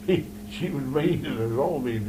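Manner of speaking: usual speaking voice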